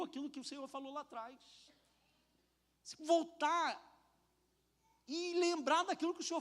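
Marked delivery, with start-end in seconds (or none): none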